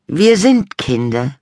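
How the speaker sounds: put-on high, loud, slow boy voice; Henrik reminding. A narrator's reading. Little brother reminding mum, high and slow. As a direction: state the obvious slowly. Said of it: "erinnerte Henrik sie".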